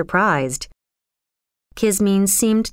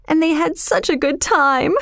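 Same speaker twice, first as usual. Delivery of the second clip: put-on high, tearful girl; acted weeping, climbing in pitch; crying heavily; 7 semitones higher than usual. A narrator's reading. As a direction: lament it in a high, upset voice. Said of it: "She was crying heavily".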